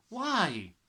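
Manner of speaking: put-on high urgent child's why; a girl crying out why. A narrator's reading. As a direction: plead sharply for an answer. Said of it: "Matilda cried".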